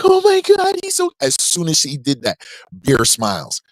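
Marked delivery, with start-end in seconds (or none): 0.0-1.1 put-on high cooing baby talk; a hint of gushing mom. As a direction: coo over the baby, pitched way up.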